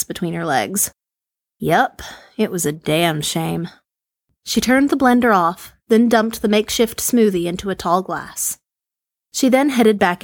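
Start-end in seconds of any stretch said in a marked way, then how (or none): none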